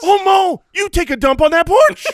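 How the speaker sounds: high, loud exclamation; mock yelling. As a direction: call it out high over the laughter. Joins in, acting out the scene.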